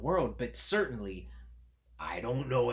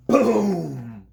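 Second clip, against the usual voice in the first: loud sound-effect boom; 8 semitones higher, 15 dB louder than usual. Acted. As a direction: make the crash big for the kids.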